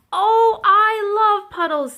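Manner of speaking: high, loud, drawn-out gushing; excited yell. A narrator's reading. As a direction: yell it with delight, stretching the words. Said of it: "Thistle yelled".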